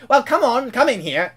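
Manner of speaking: high-starting urging call; impatient James. Acted. James to the drenched engine, impatient urging. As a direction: urge him inside, pitch jumping up at the start. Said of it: "James would be like".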